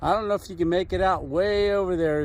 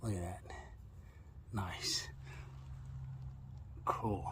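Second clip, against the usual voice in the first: hushed; 9 semitones lower, 18 dB quieter than usual. Real, not acted. Quiet admiration of glass.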